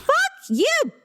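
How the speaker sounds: high-pitched retold outburst; mock outrage. Girl silently cursing her teacher after losing, mock outrage. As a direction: curse in squeaky indignation.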